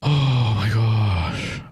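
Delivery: slow, stretched exasperated cry. Real, not acted. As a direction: drag out the oh. Overwhelmed, nothing found.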